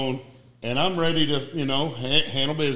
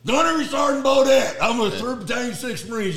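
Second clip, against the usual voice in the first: 1 defiant retort; 6 semitones higher, 4 dB louder than usual. Retold or imitated. Retold defiant answer.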